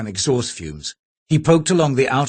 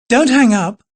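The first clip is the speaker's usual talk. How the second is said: answering machine voice; 13 semitones higher, 6 dB louder than usual. Acted. Answering machine greeting, woman's voice.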